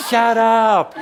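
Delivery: high, loud comic yell; playful shout. Pretend. Teacher joking with the audience, playful high shout. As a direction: yell it high over the laughter.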